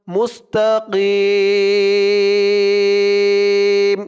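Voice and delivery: "Mustakim" with the final i held very long; three-alif stretch. Shown as a demonstration. Teacher showing the verse-end stretch, "Mustakim" drawn out long. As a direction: chant "Mustakim", sustaining the last vowel before m. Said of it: "তিন আলিফ টান".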